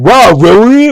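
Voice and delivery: high, loud mock amazement; mocking echo. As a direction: throw it back tauntingly, shooting up on really. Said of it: "And then he starts going".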